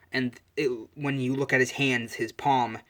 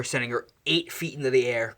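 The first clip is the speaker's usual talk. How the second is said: ordinary delivery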